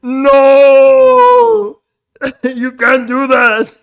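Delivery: high, loud, drawn-out mock shout; over-the-top stretched-out yell; Arnold. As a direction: bellow it slowly in imitation, breaking into laughter. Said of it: "He sounded like Arnold".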